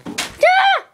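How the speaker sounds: short, loud, high yell; play scream. Pretend. Going after Luigi, sudden high scream.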